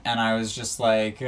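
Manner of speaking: normal talking voice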